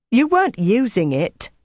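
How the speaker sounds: high-pitched quarrelling complaint; indignant row. A narrator's reading. Jelly rowing with Greta over a pouffe, indignant. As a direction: argue back, pitch jumping up.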